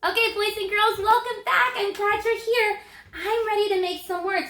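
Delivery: high, loud, welcoming teacher voice; cheery teacher greeting. Acted. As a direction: greet the kids like they just walked in. Welcoming kids back to video.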